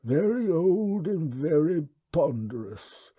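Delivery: slow, high, unhurried alien creature voice; wub's voice. Acted. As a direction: speak as a placid, courteous old beast. The wub speaks.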